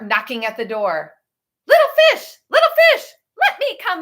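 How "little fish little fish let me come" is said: shark calling. A narrator's reading. Shark knocking at the fish's seaweed house, sing-song. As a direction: coax them like a sly shark at the door. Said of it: "roared the shark".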